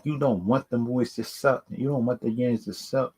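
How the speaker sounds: normal talking voice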